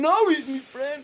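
high, drawn-out plea through laughter; hammed-up indignant insistence; mock protest. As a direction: protest to the bouncer, half laughing. Acts pleading with doorman, laughing.

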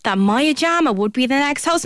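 ordinary talk

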